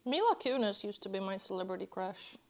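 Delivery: normal talking voice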